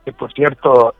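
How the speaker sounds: normal talking voice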